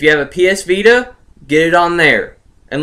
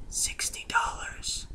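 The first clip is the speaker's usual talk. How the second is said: whispered reveal; 21 dB quieter, slower than usual. Acted. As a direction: drop to a breathy whisper for the price. Dramatic price reveal.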